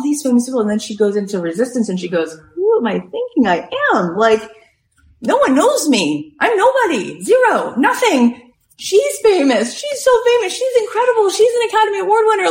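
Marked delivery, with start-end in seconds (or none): 2.5-12.5 high, escalating panicky self-talk; hammed-up insecure spiral, very high; frantic self-doubt. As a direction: climb into a squealing mock meltdown. Retells actress doubting herself against stars.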